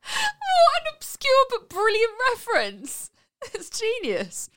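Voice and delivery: high, slow, laughing through words. Real, not acted. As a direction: laugh as you praise it. Laughing at Shrek reference.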